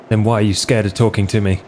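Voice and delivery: usual speaking voice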